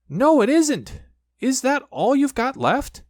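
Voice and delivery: a squeaky little pig, a bit indignant; Piglet. Acted. Piglet's voice in story.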